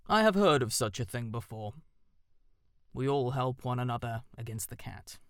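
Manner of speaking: solemn and wise; owl voice. A narrator's reading. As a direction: owl speaking of the common enemy.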